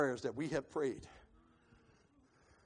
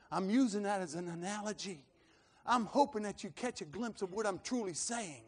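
ordinary talk, nothing marked

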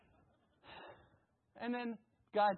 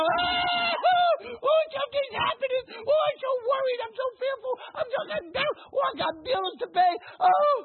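panicky whining; 7 dB louder than usual. Retold or imitated. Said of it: "I'm not gonna go".

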